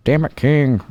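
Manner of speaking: playful commentator impression, exclaiming to a broadcast partner; Jim Ross. Podcaster exclaims to King as Jim Ross, comic impression. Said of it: "That was pretty good J R".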